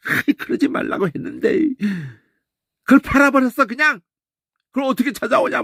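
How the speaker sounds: high, reproachful brother complaining; Reuben scolding. Retold or imitated. Reuben blaming his brothers before Joseph, high and reproachful. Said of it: "막 르우벤이 막 그러는 거지".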